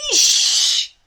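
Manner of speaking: a breathy dragon hiss; hissed fish. A narrator's reading. Dragon asks for fish again, hissed. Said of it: "said Toothless again".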